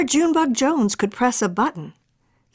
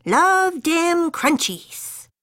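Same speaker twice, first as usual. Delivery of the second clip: put-on kitty boy voice; 9 semitones higher, much slower than usual. Kitty boy savours a fly.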